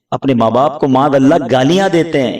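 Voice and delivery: normal talking voice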